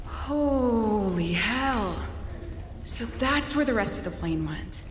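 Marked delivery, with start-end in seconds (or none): 0.0-2.0 very slow, drawn-out amazed murmur; stunned awe. Acted. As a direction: take in the sight slowly. Soldier sees plane wreckage.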